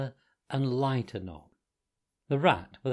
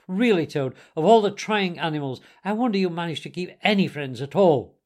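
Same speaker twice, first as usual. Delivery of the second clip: higher, louder exasperated scolding; cross scolding; 5 semitones higher, 5 dB louder than usual. A narrator's reading. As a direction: scold Toad like a fed-up friend. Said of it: "said the rat very crossly".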